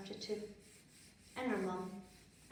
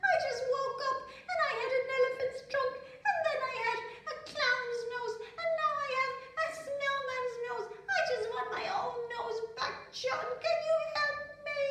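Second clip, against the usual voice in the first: put-on high, loud, fast little girl's voice; a child pouring out her troubles, pitched way up; Prunella pleading; 6 dB louder, faster than usual. A narrator's reading. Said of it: "said Prunella".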